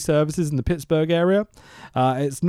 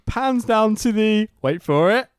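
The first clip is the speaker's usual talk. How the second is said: slow, raised build-up to a title; mock dramatic reveal; 7 semitones higher, 3 dB louder, slower than usual. Podcaster revealing the film's title card, mock dramatic. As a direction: draw out the suspense, breaking into a laugh.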